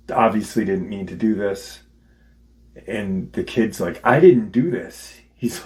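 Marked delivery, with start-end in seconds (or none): 4.0-5.2 higher, louder insistent protest; retold indignant denial. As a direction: protest it like an accused kid. Acted kid denying the killing.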